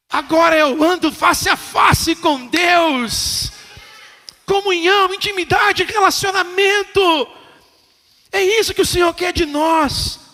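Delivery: loud, high climactic proclamation; preaching shout. Real, not acted. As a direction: declare it with full force. (proclamação gritada no clímax)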